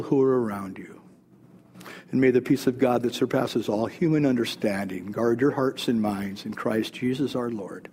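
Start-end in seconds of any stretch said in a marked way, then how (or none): 2.1-7.9 low, soft closing blessing; subdued, low-pitched pastoral benediction. Real, not acted. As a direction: pronounce a quiet blessing, settling down to the amen.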